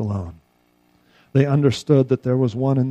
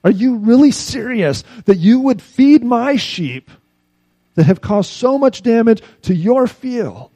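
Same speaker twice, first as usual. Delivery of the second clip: astonished; 9 semitones higher, 8 dB louder than usual. Retold or imitated. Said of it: "he looked up and he said".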